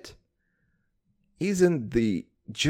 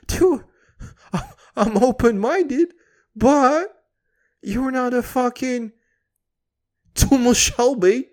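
excited rant; 10 semitones higher, 6 dB louder than usual. Acted. Escalating rant, much higher, louder.

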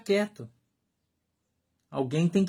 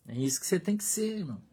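whisper; 9 dB quieter, faster than usual. Real, not acted. Pastor drives the point home.

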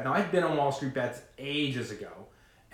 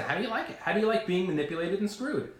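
higher-pitched goading questions; mock taunt; 6 semitones higher than usual. Little guy taunts Wall Street, mock goading. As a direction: throw it back at them, pitched up.

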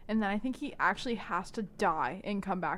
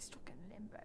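mocking murmur; 13 dB quieter than usual. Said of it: "She's like".

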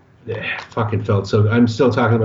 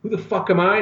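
higher, scornful comeback; Alec Baldwin sneering; 8 semitones higher than usual. Retold or imitated. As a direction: toss it back with contempt. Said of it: "And he goes".

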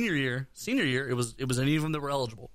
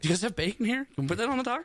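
higher, pleading order at the counter; high eager customer voice; 8 semitones higher, faster than usual. Retold or imitated. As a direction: ask like an excited kid at a taco stand. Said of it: "I'm always like".

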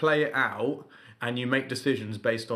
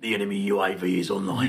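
low, brisk British officer voice; British military commander; 5 semitones lower, 3 dB louder, faster than usual. Pretend. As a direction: bark it like a war-game commander. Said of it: "I would do the British military commander voice".

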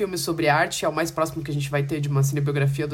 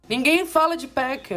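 indignado exagerado; 10 semitones higher than usual. Real, not acted. Exclamação indignada sobre filme esquecido.

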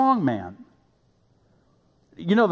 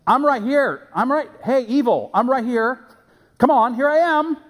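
higher, louder beckoning shout; mock calling out; 8 semitones higher, 4 dB louder than usual. Sinner beckoning evil like runway lights, mock calling out. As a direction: call out to flag someone down from afar.

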